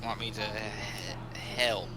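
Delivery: stumbling over the word help, slow and low; stuttering. Acted. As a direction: choke on saying help. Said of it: "try to stutter help".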